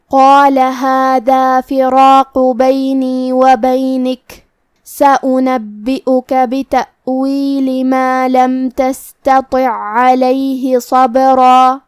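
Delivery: slow melodic tajweed recitation; Quran recitation. Acted. Reciter chanting the verses slowly for the students. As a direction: chant each phrase long and measured.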